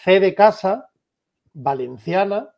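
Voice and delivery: slow, spaced-out delivery for note-taking; slow dictation. Shown as a demonstration. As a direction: spell it out slowly, letter by letter. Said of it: "lo voy a decir bastante despacio".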